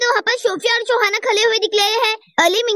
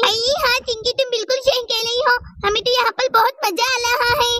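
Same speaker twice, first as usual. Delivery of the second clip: ordinary talk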